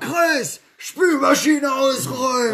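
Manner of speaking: high, loud, drawn-out holler; mock parent shouting orders; shouted parental order. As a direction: yell a chore at the kids through the house. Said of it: "Kannst du besser schreien".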